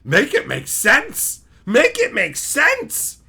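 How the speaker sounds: building from firm to a high, slow shout; escalating exasperated yell; rebelling brain. Acted. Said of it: "My brain fucking rebels. It just goes".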